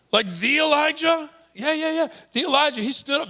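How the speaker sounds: Jesus keeps the excitement going; excited disbelief. Acted. Jesus answers his amazed disciples with eager excitement. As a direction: voice lifted high in eager wonder.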